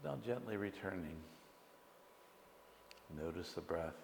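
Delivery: soft, unhurried meditation guidance with long pauses; guided meditation. Acted. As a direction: gently lead the listeners into stillness. Leads a short guided meditation.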